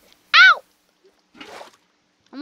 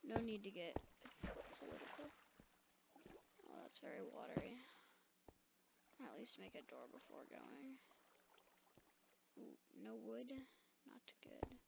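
hushed, much softer voice; whispering; 25 dB quieter than usual. Real, not acted. Gamer whispering to viewers while sneaking around. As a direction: keep it down to a whisper.

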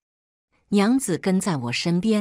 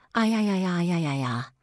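a long yelp from a sudden pinch; pained cry. A narrator's reading. Pinched at the waist, she lets out a long yelp. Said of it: "一把捏了下去".

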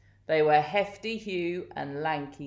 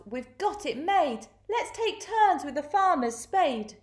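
gleeful crooks; 8 semitones higher than usual. A narrator's reading. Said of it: "The Twofies laughed".

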